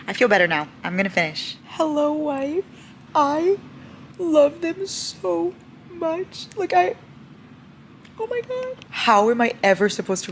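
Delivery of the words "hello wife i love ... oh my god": high, slow, choked with joy; overwhelmed, drawn-out high voice; tearful adoration. Real, not acted. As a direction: squeak it out through happy tears. Overcome by the beloved chapter.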